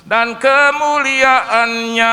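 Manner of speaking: loud, slow proclaiming; full-force preaching. Acted. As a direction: proclaim the promise with power. (berkhotbah dengan lantang)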